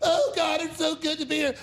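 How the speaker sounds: high gushing mock delight; mocking socialites. Mocking elites going to restaurants, high gushing delight. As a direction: gush like a pretentious diner.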